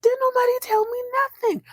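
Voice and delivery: a high, excited gasp of recognition; delighted surprise. Real, not acted. Recapper spotting an old favourite actor, high and thrilled. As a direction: squeal over a surprise. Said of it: "I said".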